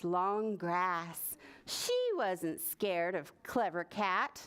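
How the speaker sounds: usual speaking voice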